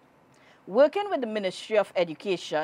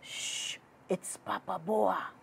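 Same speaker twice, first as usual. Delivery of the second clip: hushed, much softer whisper; 11 dB quieter than usual. A narrator's reading. Hunter warns friends of Papa Boa, whispered. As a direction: warn the others under your breath. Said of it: "whispered one".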